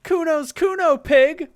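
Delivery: high, loud proud shout; a kid thumping his chest; bratty kid Kuno. Acted. Kuno shouts his own name, high and loud.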